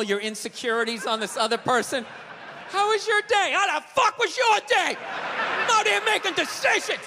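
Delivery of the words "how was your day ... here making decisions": ranting husband. Retold or imitated. Old school husband ranting at wife.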